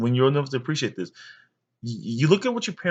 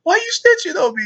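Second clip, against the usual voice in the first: put-on much higher, louder whiny kid voice; kid complaining; 6 dB louder than usual. Boy accusing the lady of snitching, high whiny voice.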